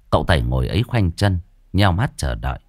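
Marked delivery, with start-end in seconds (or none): none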